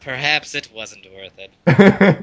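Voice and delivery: slow, loud, mock pondering; drawn out like a grand regret; regretful musing. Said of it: "We're all thinking to ourselves".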